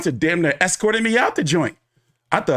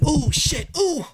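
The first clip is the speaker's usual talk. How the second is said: 1 retold shock; 11 semitones higher, slower than usual. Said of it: "And I was like".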